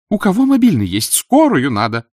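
loud, high-pitched woman's call; woman in crowd. A narrator's reading. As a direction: call out urgently for help. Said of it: "а женщина".

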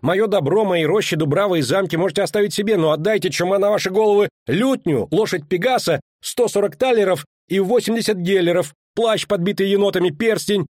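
high, insistent protest; indignant shout. A narrator's reading. Poet demanding his belongings back from officials, crying out. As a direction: rattle off the demands in a high, agitated voice. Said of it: "крикнул Лютик".

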